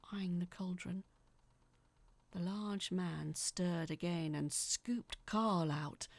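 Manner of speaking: ordinary delivery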